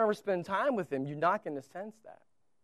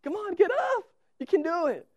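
put-on much higher, eager cheering to the toddler; cheering dad; 10 semitones higher, 3 dB louder than usual. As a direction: coax the little boy up with bright excitement. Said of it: "I have it on video".

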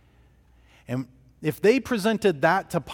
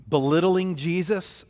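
ordinary delivery

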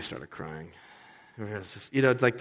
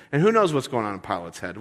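usual speaking voice

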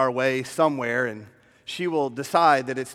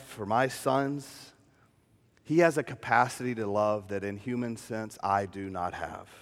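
ordinary talk, nothing marked

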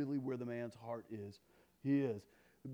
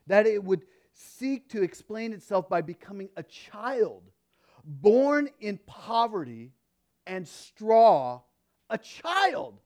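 louder, higher, slowing on the key words; fervent quote reading; 6 semitones higher, 13 dB louder, slower than usual. Acted. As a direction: build the quote to its climax. Reading [name] quote emphatically.

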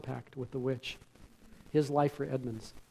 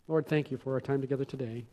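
prayer. Acted. Closing prayer.

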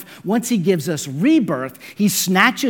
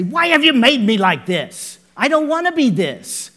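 louder, higher mock complaint; complaining clay; 8 semitones higher, 6 dB louder than usual. Clay protesting to the potter, mock indignant complaint. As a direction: whine at the potter. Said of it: "The clay does not have the right to say".

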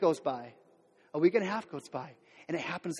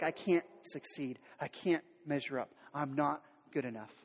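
defeated inner voice; 6 semitones lower, 8 dB quieter than usual. Pretend. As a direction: mutter it like someone beaten down. Voicing a crushed person.

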